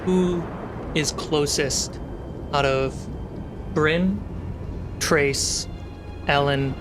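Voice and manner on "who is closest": usual speaking voice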